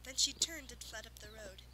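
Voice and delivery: plain reading voice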